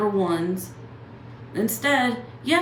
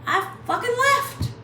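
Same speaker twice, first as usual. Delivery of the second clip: much higher, louder burst on "left"; triumphant outburst; 4 dB louder than usual. Shown as a demonstration. Bragging to viewers, high triumphant outburst. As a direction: fling out "I fucking left" high. Proud retelling of leaving.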